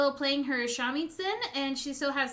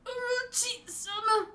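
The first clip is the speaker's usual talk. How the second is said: shadow servant's voice; 9 semitones higher than usual. Acted. Shadow calling to master Orochi, strained. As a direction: stretch it out thin and pitched up.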